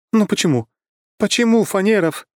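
very high, wailing complaint; dismayed lament. Acted. Companion despairing that it is this groom, high lament. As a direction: whine it in comic despair.

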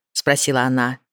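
ordinary delivery, nothing marked

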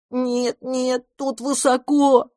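high, drawn-out whine; whining kitten. A narrator's reading. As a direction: whine like a scared little kitten. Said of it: "ныл котёнок". (жалобно ноющий котёнок)

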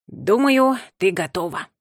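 lightly voiced kindly old pigeon; wise pigeon voice. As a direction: give the verdict warmly. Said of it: "сказал первый голубь". (мудрый голос голубя)

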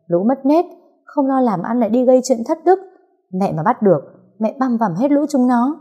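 high-pitched indignant mother; outraged threat on a child's behalf; angry cursing. A narrator's reading. Enraged mother curses the kidnappers.

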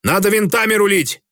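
urgent call. A narrator's reading. Alarm on the bridge.

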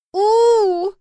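high, loud drawn-out wail; retreating dragon's howl. A narrator's reading. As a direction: wail loudly as the pet backs off. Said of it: "завопила отступившая Марси". (громкий высокий вопль дракона)

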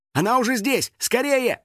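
high-pitched excited fans. A narrator's reading. Fans spreading word outside school, excited. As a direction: cry out eagerly, pitch way up. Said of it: "возбуждённые голоса".

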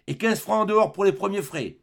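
loud, high demand; man calling out. Acted. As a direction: shout the extra charge. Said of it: "ajouta la voix d'homme". (Thénardier réclame fort, voix haute)

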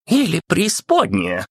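high shrill shriek of outrage; irritated shriek, high and sharp; shrieking irritated Pope. A narrator's reading. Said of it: "взвизгнул раздражённый Папа Римский".

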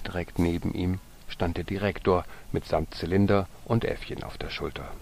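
usual speaking voice

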